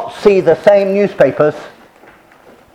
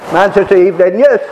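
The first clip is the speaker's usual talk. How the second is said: street newspaper cry; 3 semitones higher, 3 dB louder than usual. Retold or imitated. Newspaper seller calling to passers-by in Manchester, street cry. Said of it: "they have these special cries".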